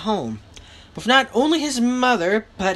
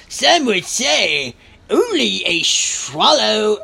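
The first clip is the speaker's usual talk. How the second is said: slow, drawn-out fairy voice; fairy woman; 4 dB louder, slower than usual. A narrator's reading. Fairy woman remarking on Matthew's looks, slow and drawn-out. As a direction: stretch each phrase slowly as the fairy woman.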